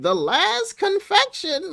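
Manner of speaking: high, slow witch croak; witchy voice. Retold or imitated. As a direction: creak it out like a witch offering a treat. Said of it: "even the way she sounded when she brought it out".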